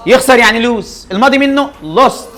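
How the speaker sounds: emphatic drilling. Shown as a demonstration. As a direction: drill the verb forms into them. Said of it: "teacher drills irregular verb".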